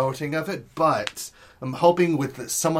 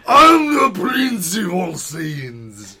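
very slow, loud, higher shouted proclamation as Vegeta; Vegeta impression; 6 semitones higher, 10 dB louder, much slower than usual. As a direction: bellow it slowly as a proud anime prince. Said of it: "he would be more".

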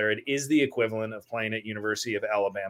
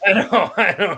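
laughing, higher and louder; 8 semitones higher, 8 dB louder than usual. Real, not acted. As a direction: laugh through the words. Laughs admitting.

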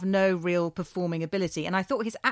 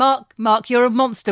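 mock scolding; 2 semitones higher, 7 dB louder than usual.

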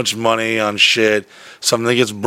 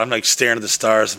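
normal talking voice

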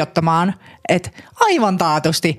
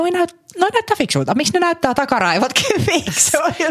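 amused disbelief; 9 semitones higher than usual. Real, not acted.

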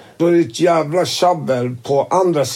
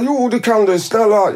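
pleading; 7 semitones higher than usual. Retold or imitated. Tony keeps begging.

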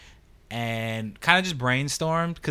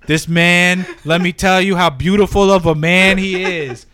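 loud, laughing, gushing praise; gushing hype man; 5 semitones higher, 10 dB louder than usual. Retold or imitated. Business partner hyping up Jack, loud and gushing. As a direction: hype him up like a showman. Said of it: "his business partner's like".

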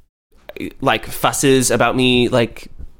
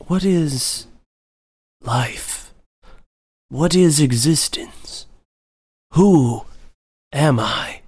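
mock existential cat musing; 2 semitones higher, much slower than usual. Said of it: "Maisie's just kind of like".

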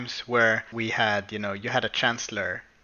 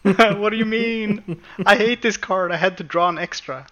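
put-on whiny kid; 7 semitones higher, 3 dB louder than usual. Imitating crushed kid.

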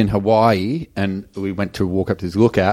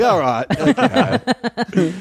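laughing; 11 semitones higher, much slower than usual. Retold or imitated. Sheepish reply in retold story.

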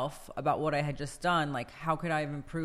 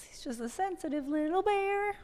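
very high, cutesy little-bear voice; squeaky-sweet baby-talk voice. Acted. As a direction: coo it way up high, as if babying a teddy bear. Cooing over partner's softer side.

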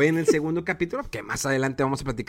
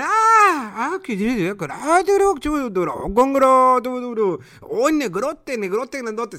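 high, swooning fangirl squeals; imitating Japanese fangirls; 7 semitones higher, 3 dB louder, slower than usual. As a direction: gush like a smitten fan girl. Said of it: "así decían las japonesas". (fans japonesas suspirando por él, voz aguda y emocionada)

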